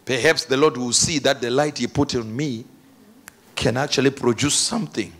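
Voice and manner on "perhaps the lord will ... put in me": normal talking voice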